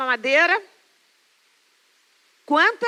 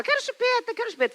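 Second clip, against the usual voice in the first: put-on higher, begging little-child voice; a small boy pleading for his pacifier, whiny; whiny toddler; 10 semitones higher, 3 dB quieter, faster than usual.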